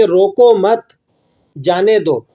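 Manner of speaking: "روکو مت" as one phrase, long break, "جانے دو"; pause after مت. Shown as a demonstration. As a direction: stop firmly after مت, then say جانے دو. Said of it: "روکو مت" پر".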